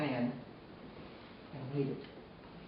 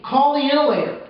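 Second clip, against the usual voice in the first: retold scream, much higher and louder; screamed order; 11 semitones higher, 12 dB louder than usual. Mother screaming at her son to call help, much higher and louder. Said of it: "then she screams at me".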